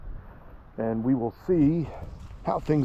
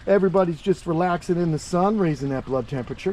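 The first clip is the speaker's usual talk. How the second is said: ordinary talk, nothing marked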